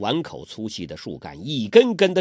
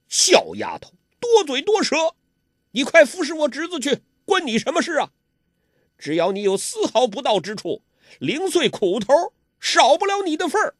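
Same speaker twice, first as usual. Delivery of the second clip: arrogant villain; 13 semitones higher than usual. A narrator's reading. Ouyang Feng ordering Huang Rong to tend his nephew, high bullying voice. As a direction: boss her around like a servant.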